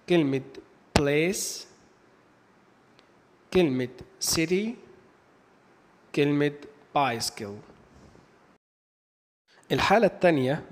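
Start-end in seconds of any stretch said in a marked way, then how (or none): 1.0-1.7 "place" ending in a clear S sound; C pronounced as S. Shown as a demonstration. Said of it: "حرف السي بينطق حرف الاس". 4.3-4.8 "city" starting with an S sound; C pronounced as S. Shown as a demonstration. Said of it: "حرف السي بينطق حرف الاس". 7.0-7.7 "bicycle" with an S sound in the middle; C pronounced as S. Shown as a demonstration. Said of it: "حرف السي بينطق حرف الاس".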